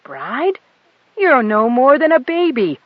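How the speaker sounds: put-on appalled at a child bride; outraged. A narrator's reading. The pilot sputters in outrage at the girl's story. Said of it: "the pilot sputtered with outrage".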